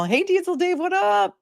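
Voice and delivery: high-pitched, playful greeting; sing-song shout-out. Real, not acted. Host hails a viewer's dad, high playful shout-out. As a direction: call out a hello, voice leaping way up.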